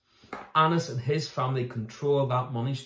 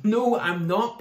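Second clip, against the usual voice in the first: high, louder snap; retort; 9 semitones higher, 5 dB louder, slower than usual. Acted. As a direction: fire it back, defensive. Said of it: "Peter retorted".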